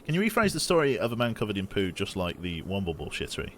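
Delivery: normal talking voice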